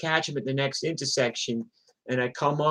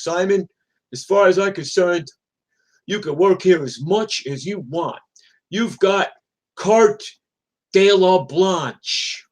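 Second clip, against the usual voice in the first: higher, louder, declamatory boss voice; Fat Dominic; 6 semitones higher, 8 dB louder than usual. Retold or imitated. Dispatcher granting his rider extra days, grand proclamation. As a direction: proclaim it grandly, drawing out the last words.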